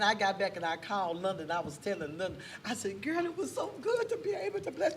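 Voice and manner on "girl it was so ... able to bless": high-pitched, delighted retelling; excited gushing. Retold or imitated. Telling a friend about blessing someone, high and gushing. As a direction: gush to a girlfriend, voice way up.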